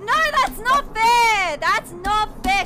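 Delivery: slow, loud protesting shout; raging gamer. Real, not acted. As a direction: yell that it's unfair. Unfair death, protesting.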